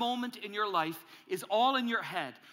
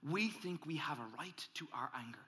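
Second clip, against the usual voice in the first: hushed whisper; 4 semitones lower, 8 dB quieter than usual. Acted. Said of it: "here's the deceit".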